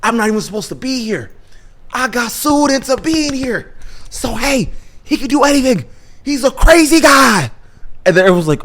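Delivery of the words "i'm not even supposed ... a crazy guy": high-pitched, pleading cartoon voice; mock-frantic confession, building in volume; Jesus. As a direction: plead the excuse in a raised pitch. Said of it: "Jesus reveals, he's like".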